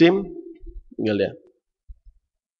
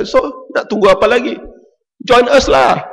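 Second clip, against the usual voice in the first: cheerful invitation; 9 semitones higher than usual. Retold or imitated. Preacher inviting his neighbour, cheerful. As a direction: welcome him in gladly. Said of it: "Saya kata".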